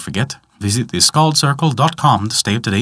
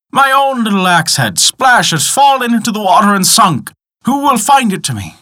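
high, theatrical wail; yelled lament; 10 semitones higher, 3 dB louder than usual. A narrator's reading. Greedy man yells for his lost axe, high wail. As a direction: cry out in hammed-up distress, very high. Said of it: "Then he began to yell".

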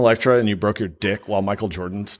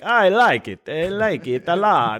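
high, drawn-out fake foreign accent; Borat; 6 semitones higher, slower than usual. Shown as a demonstration. Showing a friend his failed Spanish, Borat-like accent. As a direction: pretend Spanish, come out sounding like a mock foreigner. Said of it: "fucking Borat".

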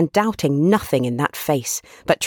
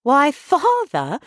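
higher, slow, drawn-out daughterly surprise; Meg; 10 semitones higher, much slower than usual. Acted. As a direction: chide your father in a light, high voice. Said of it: "said Meg".

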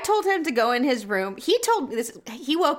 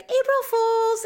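teasing sing-song; 11 semitones higher, slower than usual. Retold or imitated. Mom demos the prank punchline.

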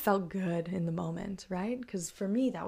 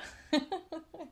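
laugh; 9 semitones higher than usual. Real, not acted. Laughing at her plan.

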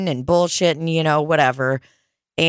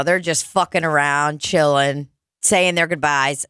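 ordinary talk, nothing marked

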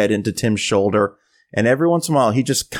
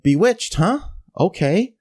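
higher questioning echo, politely unconvinced; dubious acceptance; 7 semitones higher than usual. Retold or imitated. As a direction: go along with it, doubt showing. Dubious reaction to her choice.

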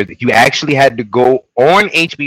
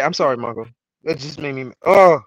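ordinary talk, nothing marked

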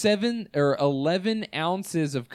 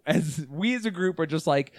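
ordinary talk

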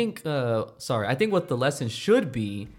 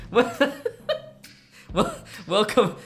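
slightly higher, drawn-out cowboy welcome; hammed-up Western accent; 6 semitones higher, slower than usual. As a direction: play a mock Texan host. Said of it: "you're not doing the right accent".

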